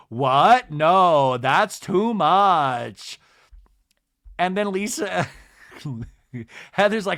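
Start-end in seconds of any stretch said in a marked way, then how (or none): 0.1-3.2 loud, appalled reality-star impression; hammed-up scandalised refusal; mock shocked protest. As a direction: object loudly, stretching the last words out. Lisa's acted shocked refusal.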